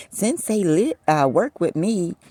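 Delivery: high, eager imitation; mocking Tansy. Imitating Tansy's eager pitch, high and chirpy. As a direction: mimic her chirpy claim up high. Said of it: "I like".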